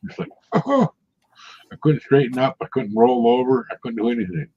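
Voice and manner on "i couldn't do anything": normal talking voice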